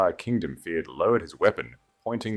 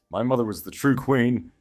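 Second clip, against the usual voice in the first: commanding; 6 semitones higher, 4 dB louder than usual. Acted. Alara asserting royal authority.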